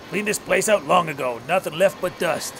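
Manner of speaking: lightly voiced old prospector; a hint of a grizzled old miner. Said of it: "Why am I using the old miner voice?".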